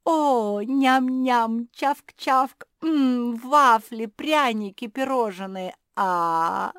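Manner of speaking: high, loud munching noises between treats; greedy gobbling. A narrator's reading. Zefirka falls on the box of sweets, greedily gobbling. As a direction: exclaim over each treat, smacking it up.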